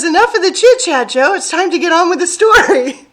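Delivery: lightly voiced pushy squash, high and loud; Bob the squash voice. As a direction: play Bob hurrying her along. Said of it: "Bob says".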